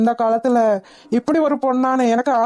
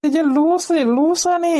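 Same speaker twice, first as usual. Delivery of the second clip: fond scolding; 5 semitones higher, much slower than usual. Acted. Husband scolds fearful wife fondly.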